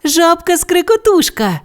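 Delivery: put-on high eager child voice; excited little Bu. A narrator's reading. Bu chimes in for Bao, high and eager. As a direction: Bu finishes Bao's sentence. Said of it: "завершила за панду Бу".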